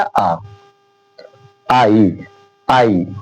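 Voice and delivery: आ then आई said low and drawn out; pronunciation demo. Shown as a demonstration. Teacher models आई for the class, low. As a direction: sound out आई. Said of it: "तो इसका क्या उच्चारण हो गया?".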